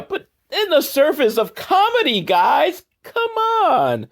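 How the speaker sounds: very high, squeaky protest; exasperated pleading. Real, not acted. Reviewer to the screenwriters about comedy, exasperated. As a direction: plead in high-pitched disbelief.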